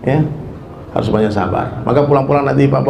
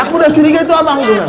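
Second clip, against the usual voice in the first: teasing wife; 11 semitones higher, 3 dB louder than usual. Acted. Wife's voice continues.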